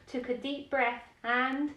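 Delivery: ordinary delivery, nothing marked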